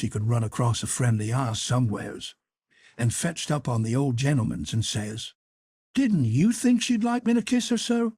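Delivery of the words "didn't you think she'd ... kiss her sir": put-on higher, puzzled innocent boy; a boy playing hurt surprise; put-on confusion; mock-bewildered Tom. A narrator's reading. Tom appeals to old gentleman.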